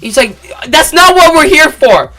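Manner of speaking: high, loud, impatient official voice; exasperated guard. Retold or imitated. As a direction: put on a fussy authority figure. Security guard correcting him.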